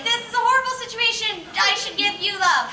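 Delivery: mock his own loving response; mock sweet reaction. Telling his parents how he responds to danger, high mock sweetness.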